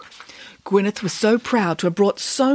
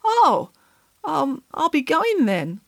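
high then slow, crestfallen exit; a hurt pig backing away; deflated; 8 semitones higher, slower than usual. A narrator's reading. Gwyneth deflated.

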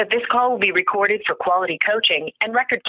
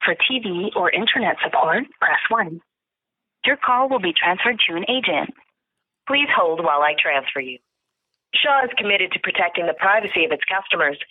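phone menu voice. Real, not acted. Shaw phone menu played.

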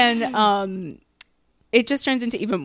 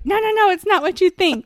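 mock-panicked, higher and louder; panicked denial; 8 semitones higher, 4 dB louder than usual. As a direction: protest in a hurry to explain yourself, imitating. Acting out man caught out denying.

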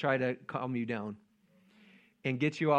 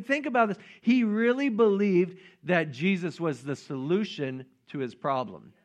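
ordinary talk, nothing marked

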